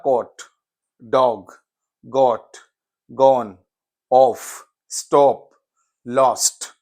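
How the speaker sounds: caught, dog, got said with a rounded British o; British o vowel. Shown as a demonstration. Teacher models the British o vowel in caught, dog, got. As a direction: round the o in each word like British English. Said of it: "जो pronounce किया है वो vowel ओ".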